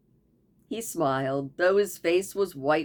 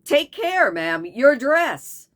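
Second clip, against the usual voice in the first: higher, louder, slow warning; urgent warning; 7 semitones higher, 7 dB louder, slower than usual. Acted. Wounded Baldos warns Beverly about her dress. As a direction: warn her off urgently, slowly.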